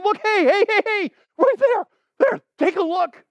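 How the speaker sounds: very high, loud excited shout; urgent calling out. Shown as a demonstration. Birder spotting a rare woodpecker, calling out excitedly. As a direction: frantically get everyone to look. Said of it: "No, I'm saying".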